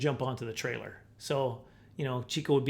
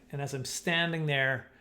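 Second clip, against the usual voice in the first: ordinary talk, nothing marked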